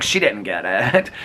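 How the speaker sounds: ordinary talk, nothing marked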